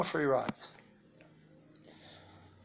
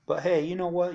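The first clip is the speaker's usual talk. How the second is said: ordinary delivery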